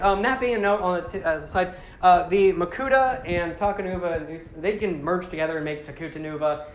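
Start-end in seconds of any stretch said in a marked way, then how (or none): none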